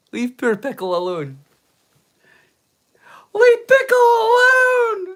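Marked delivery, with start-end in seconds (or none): 3.3-5.1 very high, slow, crying shriek. Retold or imitated. Defending [name] from chat, mimicking the tearful [name] fan shriek. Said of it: "It's like [name]!".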